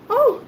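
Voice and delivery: very high, mock-shocked "oh"; hammed-up cry of shock; shocked gasp. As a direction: gasp like a slapped gentleman. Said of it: "He goes".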